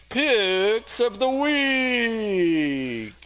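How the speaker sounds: loud echoing toy microphone announcement. Real, not acted. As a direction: announce it through an echo mic. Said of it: "That was great".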